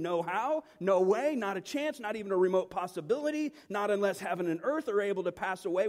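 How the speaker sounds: rapid-fire, forceful preaching; passionate emphatic denial. Acted. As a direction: hammer out each denial with rising force. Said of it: "most passionate".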